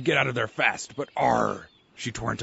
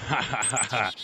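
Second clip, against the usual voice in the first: pirate laugh. Acted.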